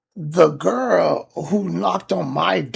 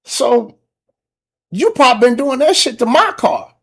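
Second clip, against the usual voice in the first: louder, higher angry rant; angry accusation; 7 semitones higher, 5 dB louder than usual. Retold or imitated. Ranting about the neighbor damaging his Benz, louder and heated. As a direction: get heated accusing her. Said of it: "I'm like".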